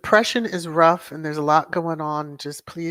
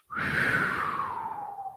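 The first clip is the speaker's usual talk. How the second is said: deep sigh; 7 dB quieter than usual. Shown as a demonstration. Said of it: "Take a deep sigh".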